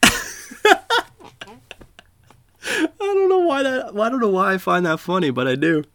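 laughing while talking, high. Real, not acted. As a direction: giggle through the words. Laughing at own joke.

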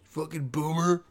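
a hint of a cranky old man snapping back; mock snarl at a nosy shopper; lightly voiced grumpy elder telling them off; rude old-man retort. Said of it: "You're just like".

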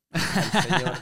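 sudden loud, high outburst; mock shout. Boy retelling the neighbor's outburst at the ball, mock shout. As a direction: blurt it out like the grumpy neighbor spotted you.

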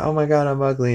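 lightly hammed-up high wail; so sad. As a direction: imitate a teen upset about her looks. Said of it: "so sad about it".

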